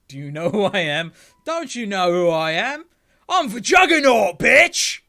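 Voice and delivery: building from loud boast to high roaring shout; hammed-up brute's battle cry, climbing to a yell; Juggernaut. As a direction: bellow it bigger with every phrase. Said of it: "I would make him sound like the Juggernaut".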